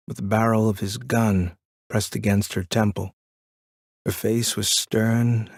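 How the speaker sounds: usual speaking voice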